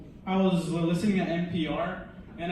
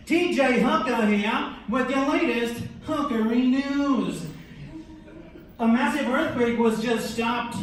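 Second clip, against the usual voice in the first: hammed-up TV anchor delivering the headline; mock evening news announcer; T J Hooker news anchor; 4 semitones higher, 4 dB louder than usual. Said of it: "my impression of, like, the news".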